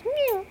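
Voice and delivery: high, far-off elk cow mew; imitated cow mew. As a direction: squeak it like a cow elk calling. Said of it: "I'm starting to hear cow mews".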